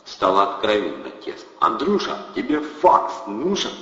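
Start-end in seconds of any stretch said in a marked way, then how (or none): 1.6-3.7 higher, slow gangster sales pitch; pushy thug pitch. A narrator's reading. Thug pushes a fax machine on Andrey. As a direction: sell it like a street hustler.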